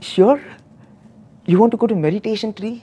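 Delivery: high, incredulous question with a little laugh; can't believe what you're hearing; asked high and fast in amazement; shocked disbelief. Retold or imitated. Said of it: "really shocked".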